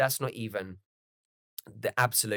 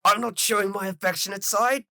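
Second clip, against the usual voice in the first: much higher, louder, huffy denial; defensive mock Vegeta; 11 semitones higher, 6 dB louder than usual. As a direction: insist proudly, embarrassed. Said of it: "It's just like".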